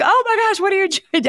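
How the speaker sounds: high, loud delighted surprise; surprised friend. Retold or imitated. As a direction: squeal at an unexpected friend. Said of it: "She's like".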